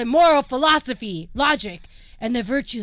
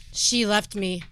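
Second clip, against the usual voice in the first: ordinary delivery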